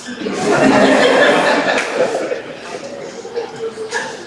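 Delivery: long, high, whooping laugh; hearty laugh. Real, not acted. Preacher teasing her that she can't cook, high hearty laugh. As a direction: crack up at your own teasing. Teasing about bad cooking.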